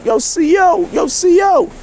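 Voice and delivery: high-pitched call of "Yo, C O" through the glass; calling out to the guard. Retold or imitated. Inmate banging on the window, calling the guard over: high call.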